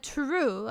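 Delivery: "True" with a drawn, Norwegian-style oo; "True" with a long, accented vowel; Norwegian pronunciation. Shown as a demonstration. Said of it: "when they say, like, true, they go like".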